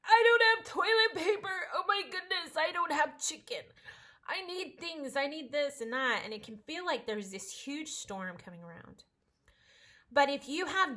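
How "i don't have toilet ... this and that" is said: high, loud mock fretting; panicked shopper. People during the crisis fretting over supplies, mock panic. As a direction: fuss over missing groceries. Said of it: "there are times where we're like".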